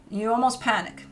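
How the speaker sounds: usual speaking voice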